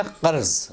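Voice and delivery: "कर्ज़" with throaty q and a z ending; careful Urdu "कर्ज़", ending in a buzzed z; correct pronunciation. Shown as a demonstration. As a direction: say "कर्ज़" the Arabic way, q and z. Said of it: "ये सही लफ्ज़ है".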